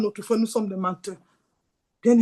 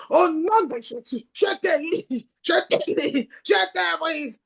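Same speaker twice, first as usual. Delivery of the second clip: mock deliverance prayer; 4 semitones higher, slower than usual.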